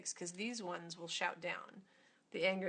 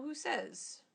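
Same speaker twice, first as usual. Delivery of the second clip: indignant challenge; 6 semitones higher than usual. Retold or imitated. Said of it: "It's angry".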